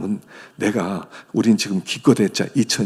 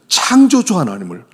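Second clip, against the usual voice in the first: normal talking voice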